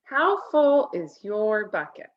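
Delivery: usual speaking voice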